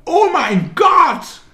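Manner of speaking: shrieked very high, loud and slow; hammed-up squeal of a contestant entering a room; stretched-out mock scream of delight; mock excited shriek. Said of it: "auf ungefähr eine Milliarde verschiedene Weisen sagen kann".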